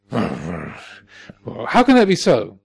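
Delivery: mock-indignant outburst; incredulous protest. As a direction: imitate a king balking in disbelief. King disputes the Buddha's answer.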